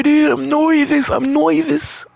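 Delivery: high whine, "nervous" as "noy-vis"; playful mispronunciation. Real, not acted. Anxious gamer joking about his nerves, "nervous" as "noy-vis".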